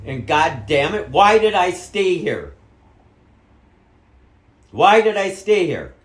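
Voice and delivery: loud, high, furious yelling; furious shout. Real, not acted. Son yelling furiously at his mother. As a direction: yell it all at her.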